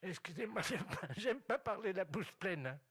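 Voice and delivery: put-on high, slowed old lady voice. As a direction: play a dotty old woman, drawn out. Prankster voicing old lady caller.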